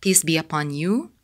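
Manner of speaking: ordinary talk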